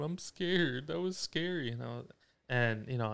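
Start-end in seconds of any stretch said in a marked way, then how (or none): 0.0-1.7 lightly voiced frightened child, higher; a hint of a small child's voice, pitched up; imitated scared kid. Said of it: "my kid's in the back seat talking about".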